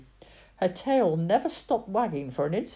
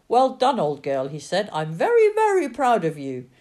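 proud praise; 3 semitones higher, 7 dB louder than usual. Acted. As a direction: cheer the dog on warmly. Praising the exhausted dog.

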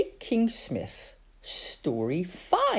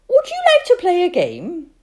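put-on high, loud child's voice; little girl voice; 9 dB louder than usual. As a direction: ask like a worried small girl.

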